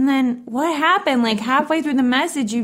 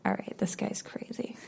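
whispering; 14 dB quieter than usual. Real, not acted. Aside about the message.

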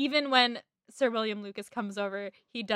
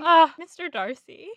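a louder, higher swooning British "Ah, Mister Darcy"; British accent; 6 semitones higher, 7 dB louder than usual. Retold or imitated. Host hamming up the British "Mister Darcy", swooning British accent. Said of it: "half bad British accent".

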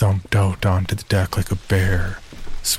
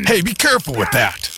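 high, urgent call out; alarmed warning; 8 semitones higher than usual. Acted. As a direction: stop him before he breaks it. Teddy warns about equipment.

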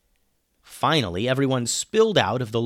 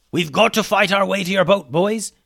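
loud, high character voice shouting; rally the boys with a raised battle cry; yelling; 8 semitones higher, 6 dB louder than usual. A narrator's reading. Hummus rallies the boys to fight to the boat, yelling. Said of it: "yelled Hummus".

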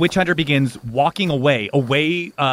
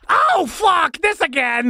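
high, whining holler; exasperated spirit; 12 semitones higher, slower than usual. Acted. Spirit finds itself possessing again, wailing in exasperation. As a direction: wail it in dismay.

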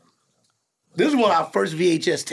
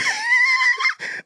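shrieking laugh. Real, not acted. Laughing at own story.

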